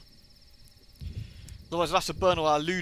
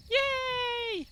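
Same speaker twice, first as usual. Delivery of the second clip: very high squeal of delight; squeaky cheer. Real, not acted. Cheers after winning friendship.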